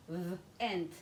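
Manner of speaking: held v, then "ent" as one chunk; partly blended sounds. Shown as a demonstration. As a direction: say "v, ent" in two parts. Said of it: "Let me come to my next syllable".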